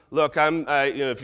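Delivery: ordinary talk, nothing marked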